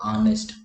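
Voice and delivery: ऑनेस्ट starting on an open vowel, no h; silent h demo. Shown as a demonstration. Teacher shows the silent h, ऑनेस्ट said "aa-nest". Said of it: "मतलब sound कैसी आ रही है".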